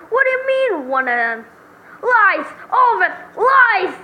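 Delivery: high, loud cries of denial; outraged defendant. Acted. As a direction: burst out in shocked disbelief.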